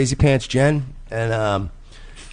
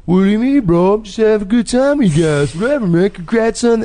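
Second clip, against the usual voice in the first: high-pitched mock-innocent bro voice; mocking the guy; 11 semitones higher than usual. Imitating the guy who claims he's just having fun, high mocking voice. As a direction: mimic him in a high, run-on voice. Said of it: "but he's doing the whole".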